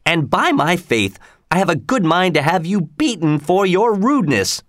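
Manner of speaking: a lord fuming at a rude peasant; mock angry sheriff. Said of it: "his voice shook with anger".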